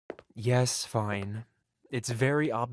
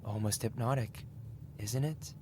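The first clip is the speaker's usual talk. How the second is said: normal talking voice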